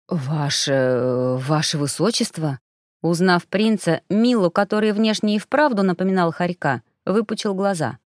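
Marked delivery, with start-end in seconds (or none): 0.1-2.6 low, hesitant stammering address; flustered stammer. Acted. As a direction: stumble over the title nervously. Startled suspect stammers title.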